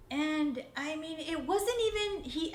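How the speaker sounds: ordinary delivery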